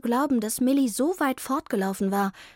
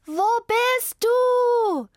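very slow, high, loud call; shouting; 13 semitones higher, 6 dB louder, much slower than usual. A narrator's reading. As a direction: yell it far and slow. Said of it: "schrie Zelda".

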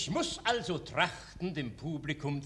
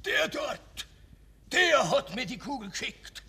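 last-strength outcry; 5 semitones higher than usual. Acted. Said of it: "deutet mit der letzten Kraft".

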